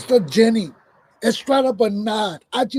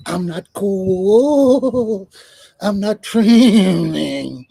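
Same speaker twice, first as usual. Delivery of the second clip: slow drawn-out; much slower than usual. Real, not acted. Drawn-out aside.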